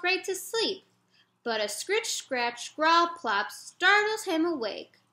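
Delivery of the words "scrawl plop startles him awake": ordinary delivery, nothing marked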